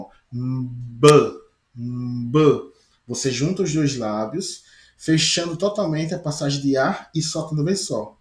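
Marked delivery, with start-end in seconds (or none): 0.0-2.7 "B" sounded as a lip pop, bilabial burst; demonstrating the B sound. Shown as a demonstration. Teacher showing students how the lips close for "B", a crisp pop. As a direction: press lips shut and pop out "B".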